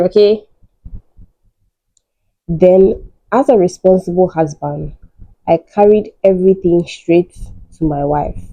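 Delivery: normal talking voice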